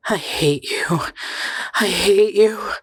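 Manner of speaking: rising, moaned repetition; moaning. A narrator's reading. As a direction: moan it, building in anguish. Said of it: "she moaned".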